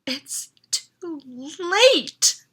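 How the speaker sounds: slow, shaking despair. A narrator's reading. Boy realising he missed the train, slow and shaking. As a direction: let the voice quiver as hope drains. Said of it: "my voice shaking".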